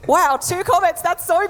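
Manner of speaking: sarcastic, high, bright-eyed praise; high sarcastic fake delight; mock delight. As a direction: fake cheer over a pitiful win. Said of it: "And I was just like".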